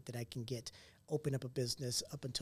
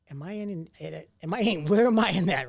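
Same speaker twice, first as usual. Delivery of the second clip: louder and louder, breaking into laughter; laughing mock worry; 4 semitones higher, 12 dB louder than usual. Asking a mentor where he ranks in his circle, laughing mock worry. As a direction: blurt it out, building into a laugh.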